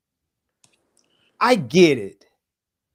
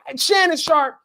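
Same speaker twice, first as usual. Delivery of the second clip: normal talking voice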